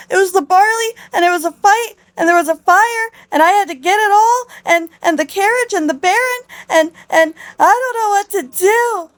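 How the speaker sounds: high, squealing mock meltdown; hysterical blubbering. Beatrice pouring out disasters to the Duchess, mock hysterics. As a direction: pile it up in breathless, hammed-up panic. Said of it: "she starts blubbering, crying, screaming".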